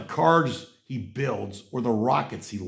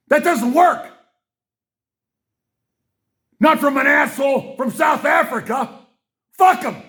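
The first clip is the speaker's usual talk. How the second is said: much higher, louder yelling; furious shout; 10 semitones higher, 4 dB louder than usual. Real, not acted. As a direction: bellow your rage at him. Continuing furious rant.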